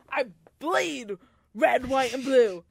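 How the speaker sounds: high, loud patriotic declaration; hammed-up patriotic boast; mock patriot. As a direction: proclaim it with over-the-top pride.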